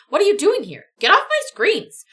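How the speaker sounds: loud alarmed shouting at the screens; startled yell. Acted. Startled pilot yells at the AI on his screens, loud and alarmed. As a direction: yell it, rattled and angry.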